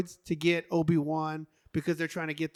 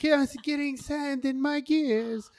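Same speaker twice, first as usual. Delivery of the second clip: C-3PO voice; 9 semitones higher, 4 dB louder than usual. Retold or imitated. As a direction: whine it like a prissy British droid. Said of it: "He's super British".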